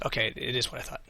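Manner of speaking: ordinary talk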